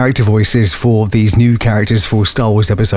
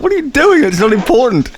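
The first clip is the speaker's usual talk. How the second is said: high-pitched mock disapproval; put-on, higher voice of scolding onlookers; imitated baffled audience; 12 semitones higher than usual. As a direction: imitate puzzled cinemagoers objecting. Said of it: "the cinema looked at us like".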